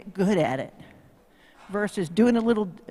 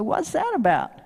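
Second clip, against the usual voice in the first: baffled; 8 semitones higher than usual. Retold or imitated. Rider puzzled by prancing horse.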